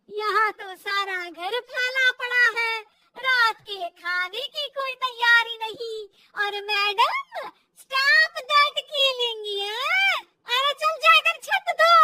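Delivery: loud, scornful nagging; angry scolding. Acted. Mother-in-law orders daughter-in-law to wash roof, angry scolding. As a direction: order her off loudly. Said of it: "सास को बहू की इस बात पर गुस्सा आ जाता है".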